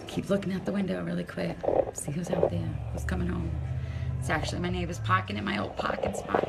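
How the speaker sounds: hushed whisper while peeking out the window. Real, not acted. Woman spying on neighbors from her window, whispering. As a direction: murmur it so the neighbors can't hear.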